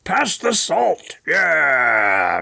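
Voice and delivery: slow, exaggerated announcer voice asking for salt; mock radio persona at the dinner table; radio voice at home. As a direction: pass the salt like a radio host. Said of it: "is that how you talk at home".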